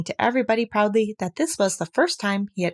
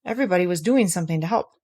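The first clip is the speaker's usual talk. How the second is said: ordinary delivery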